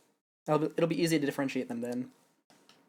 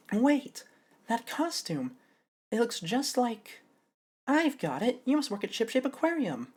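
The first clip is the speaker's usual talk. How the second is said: higher, bright surprised voice; excited realization; 9 semitones higher, slower than usual. Acted. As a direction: gasp as it clicks, then announce it. Voicing Athena's realization.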